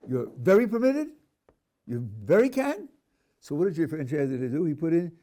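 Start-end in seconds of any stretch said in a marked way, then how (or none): 0.1-2.9 higher and louder, leaning on "very" in "You're very permitted"; playful mock emphasis. Shown as a demonstration. Teacher joking to the class about emphasizing a permission, with mock stress on "very". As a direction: push "very" hard in "you very can" to show it is absurd. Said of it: "How do you emphasize a permission?".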